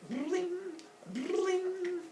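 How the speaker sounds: high, softened twang; high cartoon lyre plinks; strumming noise. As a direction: imitate plucked strings up high.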